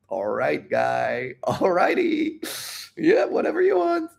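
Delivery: drawn-out singsong leaping way up high; exaggerated patronising agreement, squeaky high at the end; mockingly humouring him. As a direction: humour a fool while laughing at him. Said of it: "Everyone is laughing at you".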